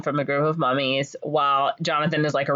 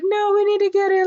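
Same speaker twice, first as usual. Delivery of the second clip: high, loud frantic objection; hammed-up desperate protest; mock panic; 5 dB louder than usual. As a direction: argue in a pitched-up panicky voice. Voicing panicked argument over dropped book.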